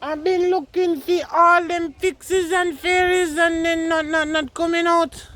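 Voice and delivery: high piping voice, pretending to hunt fairies; playful high voice. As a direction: lift the voice way up, playful and teasing. Playful high voice about pixies.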